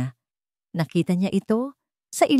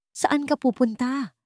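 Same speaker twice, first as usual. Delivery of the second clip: puzzled; 7 semitones higher, slower than usual. A narrator's reading. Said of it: "nagtatakang tanong ni Isabel".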